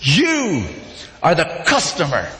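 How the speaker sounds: ordinary talk, nothing marked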